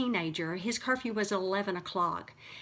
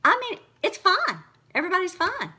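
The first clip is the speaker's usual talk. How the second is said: emphatic reassurance; 10 semitones higher, 7 dB louder, faster than usual. Real, not acted. Relieved exaggeration after conflict.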